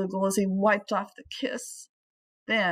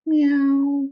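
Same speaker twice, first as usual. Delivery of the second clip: high, loud cat meow; cat meowing up at Flugel; 6 semitones higher, 8 dB louder than usual. Acted. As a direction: call it out like a contented cat. Cat meows in the sun.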